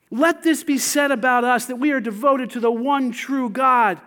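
usual speaking voice

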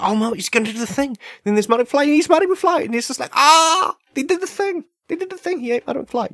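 very high squealing cry; mock fan squeal. Moviegoer watching the shark eat Marty, squealing with delight.

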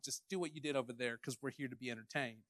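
mock them as spoiled spectators; mock entitled crowd. Crowd mocked as wanting a show.

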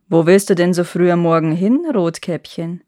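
lightly voiced wolf, a little low; a hint of wolf in the voice. A narrator's reading. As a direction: ask as a mildly sly storybook wolf. Wolf questions Rotkäppchen.

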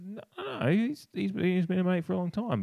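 high-voiced protest on a mate's behalf; defensive. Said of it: "Sloane would have been a lot more defensive".

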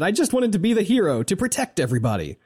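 quick high mock hero line; a hint of a starry-eyed hero; earnest hero imitation. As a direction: play the noble hero, lightly. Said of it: "and he said".

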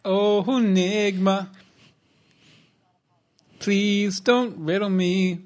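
mock plea.

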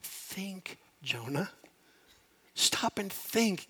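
slow down and ask him gently; God speaking softly. Retold or imitated. God quietly urging Jonah to stop, hushed and slow. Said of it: "you can almost hear God say".

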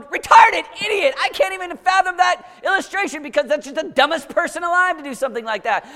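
put-on high scornful voice of a jeering teen; mocking listeners. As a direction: scoff at it. Voicing giggling listeners.

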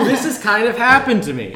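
high, loud laughing outburst; mock confession. Host jokingly answering whether this ever happened to them, laughing loudly. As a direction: blurt it out loudly through laughter.

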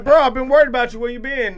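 worried friend. Retold or imitated. Worried friend voiced in story.

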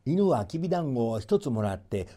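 usual speaking voice

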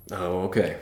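quiet, understated resignation; resigned imitation. Experienced lords hearing of an ambush, resigned. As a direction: softly accept it, like a weary veteran. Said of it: "they're resigned".

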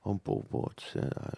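soft, small, slow whisper-like voice. Retold or imitated. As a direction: shrink into a shy nobody. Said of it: "and he's like".

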